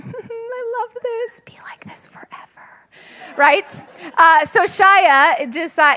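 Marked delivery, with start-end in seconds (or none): 0.0-2.4 inner whisper. Retold or imitated. Mother secretly delighting in her son's attention, whispered. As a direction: whisper it to yourself. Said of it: "But, like, internally".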